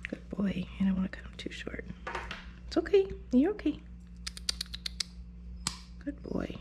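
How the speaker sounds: soft whisper to a nervous dog; whispered soothing. Real, not acted. Groomer soothing the dog during nail clipping, whispered. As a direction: keep it hushed so he stays still. Hushed while clipping nails.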